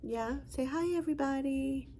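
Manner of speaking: high sing-song prompt. Real, not acted. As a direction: coax the dog to say hi. Prompting the dog.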